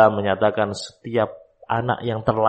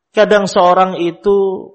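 ordinary talk, nothing marked

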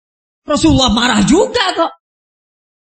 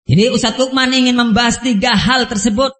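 normal talking voice

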